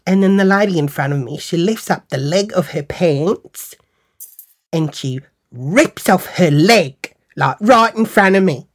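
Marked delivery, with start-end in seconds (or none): none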